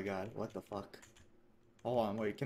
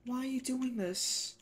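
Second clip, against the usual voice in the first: high, drawn-out victim voice; Billy; 12 semitones higher, 3 dB louder than usual. Acted. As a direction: plead slowly in a put-on high voice. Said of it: "I'm gonna do a voice for Billy".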